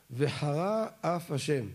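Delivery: very slow, "וחרה" drawn out before "אף"; words kept apart. Shown as a demonstration. Teacher showing a reader where to take care, slow separation. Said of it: "לכן צריך להבדיל ביניהם".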